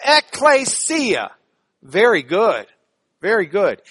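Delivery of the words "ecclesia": ECCLESIA drawn out syllable by syllable, higher; teaching pronunciation. Shown as a demonstration. Teacher models a Greek word for the class, slow, raised pronunciation. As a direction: say "Ecclesia" slowly, pitch falling away at the end. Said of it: "Can you say it with me?".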